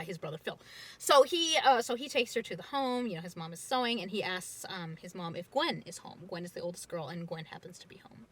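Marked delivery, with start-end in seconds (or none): none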